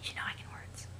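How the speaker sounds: unvoiced whisper. Sollux insisting he can talk, in a whisper. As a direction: breathe it as a secret.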